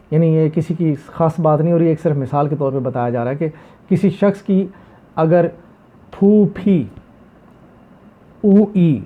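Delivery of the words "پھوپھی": ordinary talk, nothing marked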